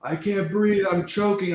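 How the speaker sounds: a hammed-up fake choke on the campaign stage; mock choking. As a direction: play-act gasping for air, mocking. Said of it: "Trump was doing this over his throat, going".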